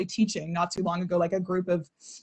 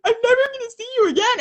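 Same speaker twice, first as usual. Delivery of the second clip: imitating a crying friend, much higher and fast; tearful classmate; much faster than usual. Imitating a teary classmate saying goodbye, high and rushed. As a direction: wail it like a sobbing kid on the last day. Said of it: "She was like".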